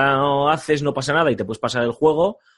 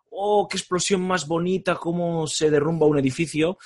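mock awe; 7 semitones higher than usual. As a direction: gush like an impressed tourist. Mock awe at flashy explosions.

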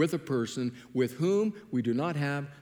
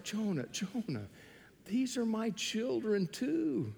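softer and slow, tender; gentle God; 6 semitones higher, 5 dB quieter, slower than usual. Retold or imitated. God tenderly answering angry Jonah, soft and slow. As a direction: say it gently, slowing down.

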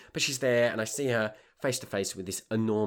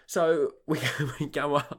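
laughing through the words; 5 semitones higher, 4 dB louder than usual. Real, not acted. Laughing at his own story to listeners. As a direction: chuckle as you tell it. Laughing while retelling the climb.

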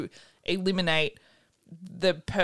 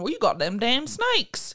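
slow Southern drawl on "damn snakes"; 5 semitones higher, slower than usual. Retold or imitated. Ranger warning her about snakes, in a Southern drawl. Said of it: "He wasn't Southern. He didn't sound like that".

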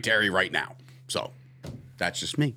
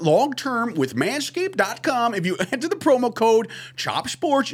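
higher, slow insider news voice with laughs; Adam Schefter voice; 10 semitones higher than usual. Pretend. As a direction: play a breaking-news reporter, half-laughing. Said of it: "do it in the Adam Schefter voice".

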